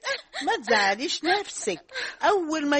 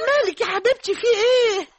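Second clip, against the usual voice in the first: higher and louder, worried; alarmed; 7 semitones higher, 4 dB louder than usual. Acted. Mother on the phone to crying daughter, alarmed. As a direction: panic for her child.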